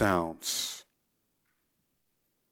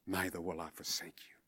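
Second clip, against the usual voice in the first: whispered promise; 5 semitones lower, 17 dB quieter than usual. Acted. God's promise, tender close.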